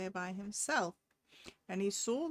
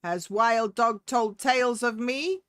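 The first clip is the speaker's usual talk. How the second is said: loud, indignant demand; cat angrily accusing the woman; angry cat; 3 semitones higher, 11 dB louder than usual. A narrator's reading. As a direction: bristle and raise the voice at her. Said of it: "Cat grew very angry and said".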